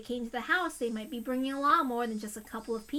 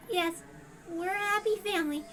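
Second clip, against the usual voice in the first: put-on lightly raised girl's voice, cowed; frightened girl repeating what she's told; young girl voice; 6 semitones higher than usual. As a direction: answer like a scared child.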